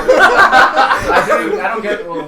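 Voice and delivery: words broken by loud laughter; can't get the words out for laughing. Real, not acted. Bursting into laughter at table.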